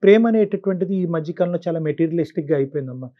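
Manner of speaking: ordinary talk, nothing marked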